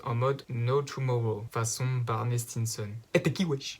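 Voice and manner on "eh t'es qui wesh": a touch higher, cocky street challenge; street tough. Acted. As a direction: toss it like a young tough. (petite voix de racaille)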